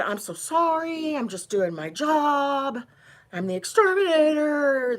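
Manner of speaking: mock his limp apology; mocking the exterminator. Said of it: "And he said".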